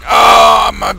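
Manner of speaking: hammed-up undead complaint; zombie. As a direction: groan hungrily like a zombie. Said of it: "He's like".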